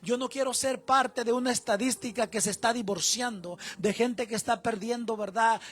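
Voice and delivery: loud, rousing sermon delivery; fiery preaching. Acted. Pastor urging the men to be strong fathers, fiery preaching. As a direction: preach it at full force to the congregation.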